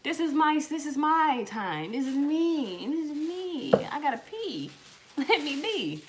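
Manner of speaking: high, loud, slow pleading shout; begging the kids for privacy; mock exasperated yell at knocking sons; yelling through the door. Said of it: "Knocking on the door".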